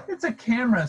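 high, lightly voiced little penguin. A narrator's reading. Little penguin tells the others, high excited. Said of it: "says the little penguin".

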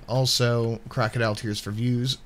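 ordinary talk

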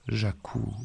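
soft, high moan. Acted. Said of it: "gémit la vieille". (gémissement doux de vieille femme)

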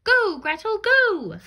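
high, loud urgent cheer. Acted. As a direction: urge her on excitedly. Cheering Gretel on.

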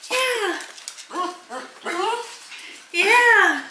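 high, loud, bubbly talk to the dog; excited pet voice. Real, not acted. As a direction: hype the dog up that her dad's home. Excited pet talk, dad's home.

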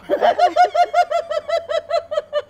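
real laughter going very high. Real, not acted. The host laughing with Cida about the countryside. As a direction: laugh along, high and free.

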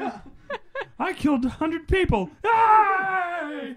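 high squeaky cheer, laughing; mock cheer. Joining the joke as a gleeful squeaky Ewok cheering. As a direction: gleefully cheer over the body count.

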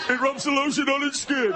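hammed-up Buffalo Bill line; creepy serial killer. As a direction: deliver it like a giggling psycho. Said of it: "He's a little too serial killer".

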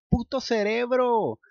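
high, loud, slow outburst; frantic curse. Acted. Luke furious at his brain, loud curse. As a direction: spit out puto cerebro.

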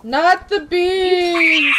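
slow, high, laughing shout; Not the bees shout. Pretend. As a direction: wail it like the meme. Nicolas Cage meme quote.

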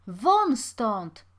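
loud, forceful shouted order. A narrator's reading. Watchman pushing the pauper onto the road, shouting. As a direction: shout to throw him out. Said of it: "krzyknął jeszcze głośniej przybyły".

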